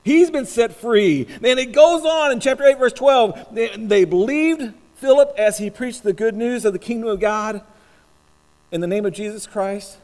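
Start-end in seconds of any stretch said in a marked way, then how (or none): 0.0-6.1 loud, higher pulpit exhortation; forceful, joyful preaching. Acted. Preacher urging the church to joy in the Lord, forceful preaching. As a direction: proclaim the joy with full force.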